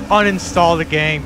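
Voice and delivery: loud gamer taunt; raging gamer. Real, not acted. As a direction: yell it at the loser. Trash talk over voice chat.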